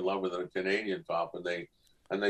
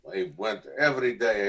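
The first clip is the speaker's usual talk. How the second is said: usual speaking voice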